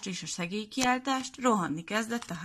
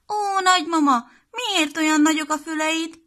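put-on high, loud child's puzzled question; a little girl amazed at grandma's ears; wondering little girl; 8 semitones higher, 6 dB louder than usual. Piroska questions disguised wolf.

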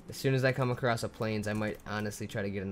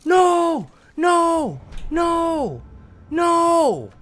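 real frantic screams, much higher and louder; a gamer screaming as his stuff is lost; 11 dB louder than usual. Real, not acted. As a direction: wail each no in rising horror. Watching everything get lost.

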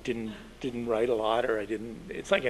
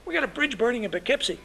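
angry editor shout; 14 semitones higher than usual. Retold or imitated. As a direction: yell at the newsroom for a missing quote. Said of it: "he says".

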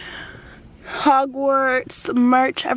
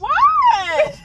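shout. Real, not acted. Reacting in car.

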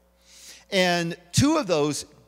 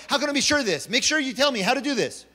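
curt demand; 7 semitones higher, faster than usual. Retold or imitated. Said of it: "he's also very cut and dry, very to the point".

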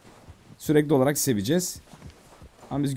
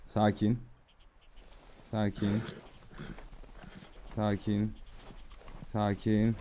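soft, low calming voice; soothing; 5 semitones lower, 12 dB quieter, much slower than usual. Pretend. Player calming a spooked horse, soft and soothing. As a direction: gently settle a nervous animal.

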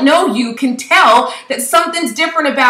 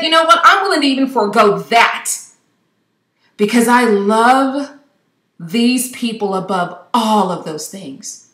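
tender vow; 4 semitones lower than usual. Retold or imitated. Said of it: "But he said".

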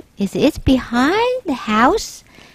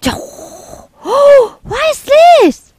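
high gasping alarm; child spotting something scary in the park; startled gasp; 13 semitones higher, 6 dB louder, much slower than usual. Acted. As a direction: gasp and cry out in shock. Boy spots a shark.

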